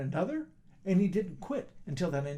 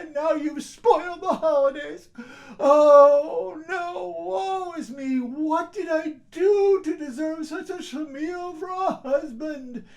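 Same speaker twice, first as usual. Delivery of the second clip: high, loud lament; wailing scolding; 11 semitones higher, 9 dB louder than usual. Acted. Wife laments to husband, high and loud wailing. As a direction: wail that the holiday is ruined.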